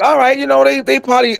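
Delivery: high, level self-reassurance; high-pitched reasoning. Retold or imitated. Storyteller excusing the stares he got, high and reasoning. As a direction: talk yourself into it, pitched high. Rationalizing their stares to himself.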